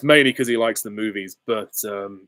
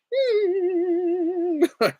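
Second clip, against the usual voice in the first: Glinda trill. Retold or imitated. Glinda trill after quote.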